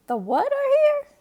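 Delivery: very high, loud disbelief; Mama can't believe her ears; shrieking surprise; shocked cry. A narrator's reading. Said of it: "cried Mama".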